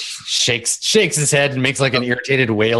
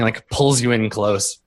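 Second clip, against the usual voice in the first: usual speaking voice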